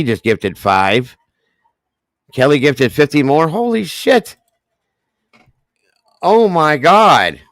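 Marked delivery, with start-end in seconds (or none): 2.3-3.5 excited. Real, not acted. Reading out huge gift. 3.5-4.4 high shocked holy shit; shocked exclamation. Real, not acted. Reacting to fifty gifts. 6.2-7.4 loud, slow oh my God; drawn out in awe; stunned. Real, not acted. Overwhelmed by gifts.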